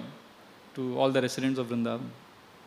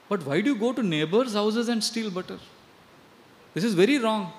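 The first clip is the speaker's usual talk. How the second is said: mildly higher chiding mother; a hint of a mother's reproach; gentle chiding; 5 semitones higher than usual. Yashoda chides Krishna.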